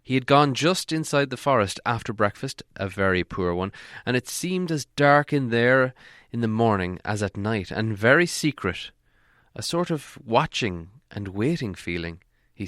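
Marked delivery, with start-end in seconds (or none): none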